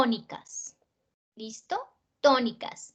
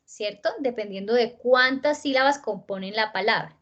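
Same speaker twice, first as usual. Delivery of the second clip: ordinary talk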